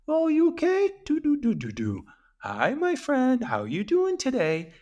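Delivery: high sing-song voice cooing to an infant; baby talk. Shown as a demonstration. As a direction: coo it like talking to a baby. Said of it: "infant baby talk".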